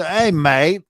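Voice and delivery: playful Australian hail; Australian accent. Acted. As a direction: call out to a pal in mock Australian. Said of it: "There was an Australian guy".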